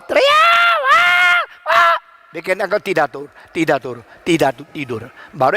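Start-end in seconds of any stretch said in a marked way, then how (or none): 0.0-2.0 put-on very high, loud baby screams; piercing mock cry, long held wahs; imitated baby wailing. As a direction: wail like a newborn at night.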